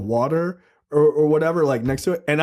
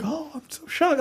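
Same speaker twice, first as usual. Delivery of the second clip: mock shocked; 10 semitones higher, 6 dB quieter than usual. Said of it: "I wasn't even like".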